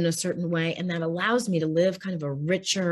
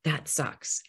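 softer, heartfelt That sucks; quiet sincere acknowledgement; 4 semitones lower, 6 dB quieter than usual. Shown as a demonstration. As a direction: say it gently and mean it. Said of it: "just taking that moment to say".

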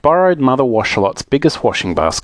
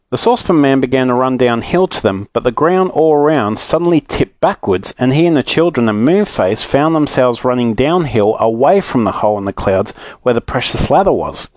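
ordinary delivery

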